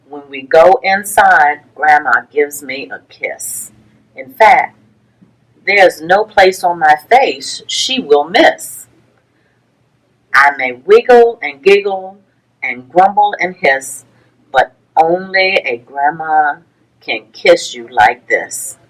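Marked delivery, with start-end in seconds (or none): none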